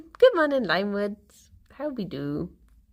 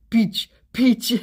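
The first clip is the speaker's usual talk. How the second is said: mock French accent; 5 dB louder than usual. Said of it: "a thick French accent".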